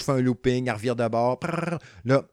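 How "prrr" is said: high trilled ship sound; playful mouth-made motor purr; rolled engine noise. Acted. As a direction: trill like a ship whirring round. Imitates the ship swinging back round.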